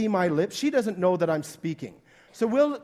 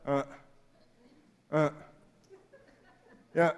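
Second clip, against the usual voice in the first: drowsy, noncommittal replies; absent, minimal acknowledgement; half-listening grunts; 4 semitones lower than usual. Retold or imitated. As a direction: answer without really listening. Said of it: "our response is".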